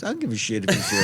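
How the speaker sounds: high, fast, fed-up protest; exasperated retort. Retold or imitated. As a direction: snap back that you don't care. Angry retort to manager on phone.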